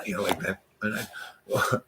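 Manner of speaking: plain reading voice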